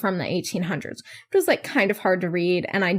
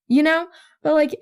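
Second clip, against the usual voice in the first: normal talking voice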